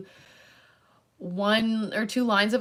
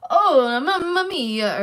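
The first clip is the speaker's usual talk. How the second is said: loud, high, drawn-out Italian exclamation; fake Italian accent; 8 semitones higher, 8 dB louder, much slower than usual. Imitating Sean's bad Greek that came out Italian, exaggerated Italian accent. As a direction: put on a hammy Italian accent. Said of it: "it was an Italian accent".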